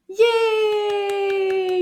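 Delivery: high, loud, drawn-out cheer; excited cheer. Real, not acted. Teacher cheers the children's success.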